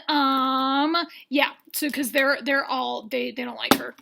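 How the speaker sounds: normal talking voice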